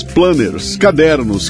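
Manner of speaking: ordinary talk, nothing marked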